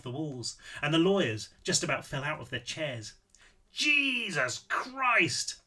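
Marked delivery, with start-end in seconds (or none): 3.7-5.6 high, drawn-out yelp of shock; startled yell. A narrator's reading. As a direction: stretch it out, pitched way up. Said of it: "yelled Seth in his heavy Austrian accent".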